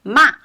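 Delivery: MA short, falling sharply; fourth tone. Shown as a demonstration. Teacher introducing the last tone, "ma" in a quick fall. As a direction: drop "ma" quickly from high. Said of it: "the fourth tone".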